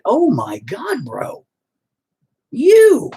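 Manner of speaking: high, loud burst of disbelief at seeing him; stunned recognition. Retold or imitated. Seeing the bullied classmate years later, stunned loud outburst. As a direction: gasp it out, stunned to run into him.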